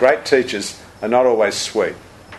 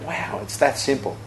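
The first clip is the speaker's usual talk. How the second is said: softer, hushed wonder; quiet awe; 8 dB quieter than usual. Retold or imitated. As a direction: breathe it out in quiet amazement. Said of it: "And I went".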